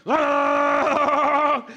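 loud, high sustained yell; frantic hummingbird yelled out at the top; imitated hummingbird frenzy. Preacher mimics a straining hummingbird for the church, frantic high yell.

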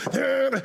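single high vocalized hit; mock instrument stab; imitated church band riff. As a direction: punctuate like the backing band. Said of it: "they help you out".

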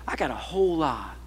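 low, soft admission; hushed, humbled reply; subdued confession. Retold or imitated. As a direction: own up quietly, voice dropped. Retold confession to the lady.

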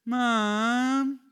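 loud, high nagging call of Mom; whiny child calling. Retold or imitated. Child calling mother in the morning, loud whiny call. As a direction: call across the house, whining. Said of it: "sounded a bit like that. A bit whiny".

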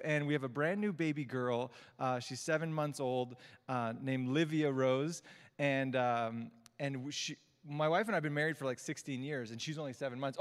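usual speaking voice

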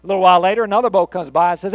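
normal talking voice

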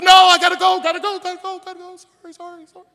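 gotta go, trailing away out of earshot; priest hurrying off. Retold or imitated. Priest running away from the beaten man, fading high voice. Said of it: "his voice silencing as he goes away".

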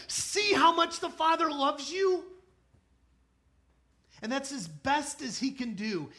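shouted sermon climax with a laugh; full-force preaching. Acted. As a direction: command them to stop and look.

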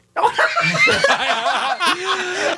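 high, sudden laughing fit; burst of laughter. Real, not acted. Guest teasing the host about praying, real burst of laughter. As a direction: crack up at your own answer. Cracks up at host's question.

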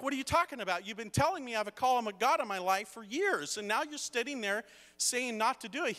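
high, indignant retold outburst; upset protest. Son confronting his dad over the call of God, upset. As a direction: argue back exasperated, pitch well up. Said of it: "I'm getting upset".

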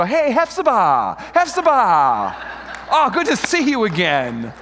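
loud, high, slow cheery hallo with a laugh; mock greeting. Greeting someone whose name you forgot, cheery loud call. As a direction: hail a half-forgotten friend loudly.

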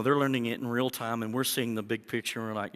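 ordinary talk, nothing marked

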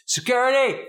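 high, loud mock yell; pretend yell. Preacher imagining an interrupted sermon, shouting for security. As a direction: holler for security mid-sermon. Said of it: "I would yell".

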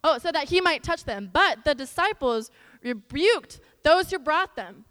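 put-on reading the Bible story aloud to kids; earnest children's Bible reading; scripture reading. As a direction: read it out clearly to a room of children.

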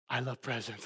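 soft, low little-kid voice, imitated; little daughter's confession. Little daughter confides in her daddy, very softly. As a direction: quietly imitate a small child confiding. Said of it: "She goes".

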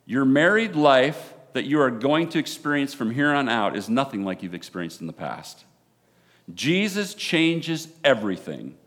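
loud, fervent wedding sermon; preaching. Real, not acted. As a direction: preach it with full conviction.

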